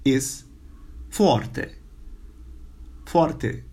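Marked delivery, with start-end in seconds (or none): 1.1-3.6 forte with a rolled r and open e; Romanian forte. Shown as a demonstration. Teacher models forte for students. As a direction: say forte, trilled r. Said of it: "The word for very is".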